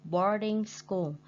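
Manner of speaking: usual speaking voice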